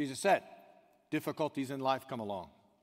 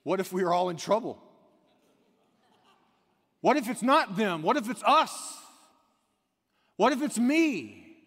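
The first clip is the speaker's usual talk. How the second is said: preacher crying out the doubt aloud to the church, high and loud; rising anguished cry; 5 semitones higher, 7 dB louder than usual. Acted. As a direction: climb to a desperate plea. Preacher building to self-questioning cry.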